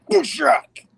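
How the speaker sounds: loud, high comic-book crash noise; imitated crash effect. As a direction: burst out with the sound effect. Reads out a comic sound effect.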